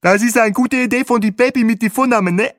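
high, loud squawk into a headset; quacky high tour-guide voice. A narrator's reading. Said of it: "quäkte Bahe in sein Headset".

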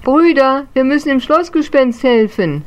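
urgent little ghost shout; calling out. Acted. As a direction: rouse the brothers to help.

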